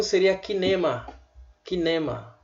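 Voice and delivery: Kinema said with a hard K; Hebrew-style kinema. Shown as a demonstration. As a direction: start Kinema with a K sound. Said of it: "Se fosse em hebraico".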